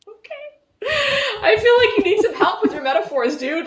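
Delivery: high laughing scolding of the author. Real, not acted. Streamer mocking the author's metaphors, laughing high. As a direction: tease the writer through laughter.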